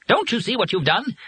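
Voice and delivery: very high-pitched angry bird voice; frantic accusation. A narrator's reading. Bird blames Joe for what he did, frantic. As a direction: accuse in a high, agitated cry.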